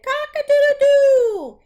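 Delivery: a high, loud cock-a-doodle-doo; loud rooster crow. Acted. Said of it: "crowed very loudly".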